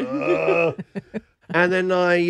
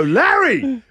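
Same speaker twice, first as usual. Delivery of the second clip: firm call; 9 semitones higher, 3 dB louder than usual. Retold or imitated. Calling the cat firmly.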